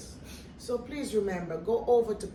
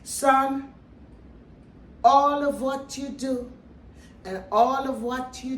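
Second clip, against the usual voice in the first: mother's voice; 4 semitones higher, 8 dB louder than usual. Retold or imitated. Mother voiced in retold story.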